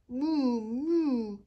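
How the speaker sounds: loud, high mooing like a cow. Acted. Cow answering Armando with a loud moo. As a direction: moo back like a friendly cow.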